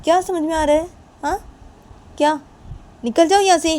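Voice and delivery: high, loud dismissal; angry challenge. Acted. Demanding an explanation and ordering out, angry. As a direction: challenge sharply, then order them out.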